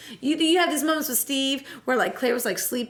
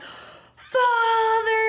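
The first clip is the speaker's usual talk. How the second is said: high, drawn-out cry of dismay; mock anguished cry; 9 semitones higher than usual. Steve facing his zombie father, mock anguished cry. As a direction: wail it out in hammed-up anguish.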